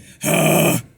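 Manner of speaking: gritty loud huff; Batman-style grunt. Shown as a demonstration. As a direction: growl out a gravel grunt. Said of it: "your Batman thing".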